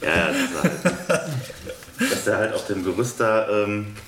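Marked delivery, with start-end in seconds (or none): 0.0-2.1 laughing. Real, not acted. Player laughs, loses the thread.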